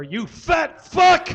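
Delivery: high, loud, drawn-out insult; furious shout. Acted. Livid chef berating the cook over butter, shouting. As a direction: explode and yell each word slowly.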